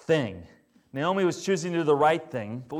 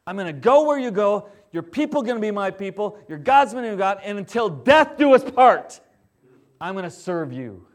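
louder, firm vow; indignant Ruth; 4 semitones higher, 7 dB louder than usual. Retold or imitated. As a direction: declare it with stubborn loyalty. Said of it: "Ruth just gets indignant".

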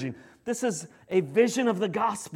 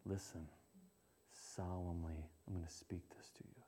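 whispered, much softer and grave; solemn whisper; 11 semitones lower, 24 dB quieter than usual. Shown as a demonstration. Parent to teenager, a solemn whisper. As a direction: whisper it solemnly to draw them in. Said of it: "try lowering your voice".

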